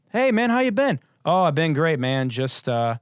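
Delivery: mock small talk. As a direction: play both guests as bored party clichés. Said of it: "it's literally like".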